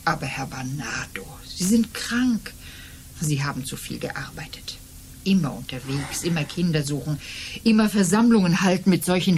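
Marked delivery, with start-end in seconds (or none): 0.0-4.8 worried whisper. Acted. Landlady Frau Kelly whispers to her sick lodger, worried whisper. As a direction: whisper it like you're at a sickbed.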